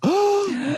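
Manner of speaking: sharp, high imitated crowd gasp; a quick high intake of breath; shocked gasp. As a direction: gasp like a stunned room. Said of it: "everyone's like".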